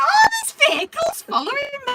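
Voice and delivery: high, loud oblivious realisation; mock cluelessness. Driver retelling every wrong turn, high, loud mock cluelessness. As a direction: exaggerate your own dim thought. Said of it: "And I'm so thick, I'm thinking".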